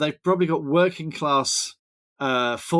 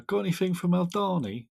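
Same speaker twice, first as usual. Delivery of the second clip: put-on quick line in a mild Thames Estuary English accent; a light touch of a Surrey and Kent English accent; 7 semitones higher than usual. A shop customer asks in a light Thames Estuary English accent. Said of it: "British accent for you where the guy goes".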